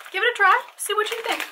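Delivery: high bright sales pitch; mock ad pitch. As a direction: sell it like a cheesy commercial. Cheery ad-style invitation.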